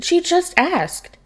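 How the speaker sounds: high, slow indignant protest; indignant squeaky protest. Acted. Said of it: "Green Arrow protested".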